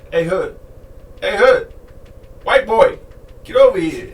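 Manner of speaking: playful shouted summons; mock Vince shout. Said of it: "Vince would be like".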